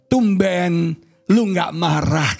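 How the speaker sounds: slow, drawn-out retold taunt; provoking wife. Wife to husband after burning his trousers, slow provoking taunt. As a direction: imitate a wife needling her husband. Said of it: "istri-istri kita ngomong itu menantang".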